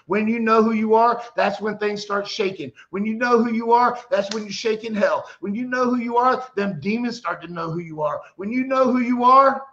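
loud, lifted refrain hammered on every return; preaching refrain. Real, not acted. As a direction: build each repeat like a drumbeat.

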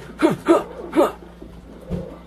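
sharp, high, breath-only gasps; panicked gasps. Real, not acted. Player caught in a game fight, sharp panicked gasps. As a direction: gasp repeatedly in fright.

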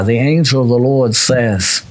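usual speaking voice

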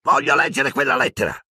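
put-on spoiled boy's demand; mildly loud demand. A narrator's reading. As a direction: insist like a spoiled boy. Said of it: "disse forte".

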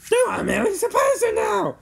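high, loud alarm; alarmed shout. A narrator's reading. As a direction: cry out in alarm at top pitch.

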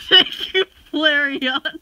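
loud laughing gratitude; laughing thanks. Real, not acted.

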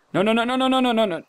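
high, rapid-fire string of panic; panicked. Real, not acted. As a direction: rattle it off in alarm. Panics under sudden attack.

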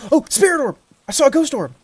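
high, loud, thrilled exclamation; excited. Real, not acted. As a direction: blurt it out in real excitement. Spotting a ghost orb.

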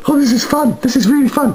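high, giddy excitement; mock glee. Gleefully pressing the subscribe button, high giddy voice. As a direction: squeal with fake joy.

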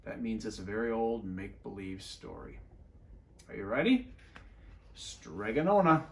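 ordinary delivery

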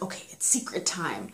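conspiratorial secret whisper; hushed, unvoiced aside. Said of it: "because whispering".

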